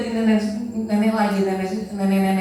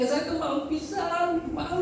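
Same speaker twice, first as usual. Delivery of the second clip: high whining complaint; 10 semitones higher, 4 dB quieter, slower than usual. Retold or imitated. Pastor complains to God.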